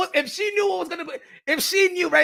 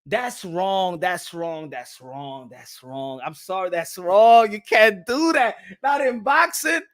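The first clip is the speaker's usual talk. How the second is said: normal talking voice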